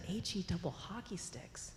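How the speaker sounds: a secretive whisper. Real, not acted. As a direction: whisper the taboo word. Avoiding saying hell to kids.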